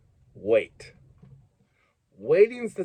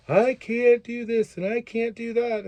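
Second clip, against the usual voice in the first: high-pitched mock whine; whining complaint; 8 semitones higher than usual. Mocking someone who only comes to complain, whiny. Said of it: "you're just coming to me to complain".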